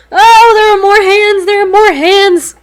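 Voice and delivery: high, loud terrified cry; panicked shout. Real, not acted. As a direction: yell it in real panic.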